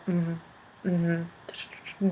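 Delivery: low, soft absent-minded hums; preoccupied pappy. Acted. Pappy half-listening to mama while working, low preoccupied murmur. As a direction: hum along while busy working. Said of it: "doing his work".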